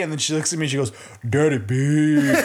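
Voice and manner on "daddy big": put-on small child putting on a big deep voice; slow, loudened mock-deep kid voice; deep toddler voice. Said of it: "she made her voice deep for it".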